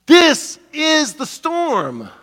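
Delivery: high, loud and very slow, each word weighted; slow preaching emphasis. Real, not acted. Pastor telling the church this is the storm, slow and loud. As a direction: lean hard on every word.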